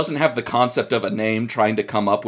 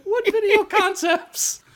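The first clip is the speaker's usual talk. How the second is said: incredulous; slower than usual. Real, not acted. Incredulous question.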